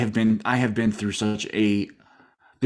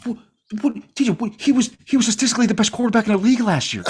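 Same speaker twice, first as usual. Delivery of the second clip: much higher, louder and fast, in disbelief; can't believe what you just said; incredulous protest; 12 semitones higher, 3 dB louder, faster than usual. Real, not acted. Cohost protests that the quarterback was the league's best, incredulous and high.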